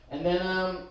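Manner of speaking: usual speaking voice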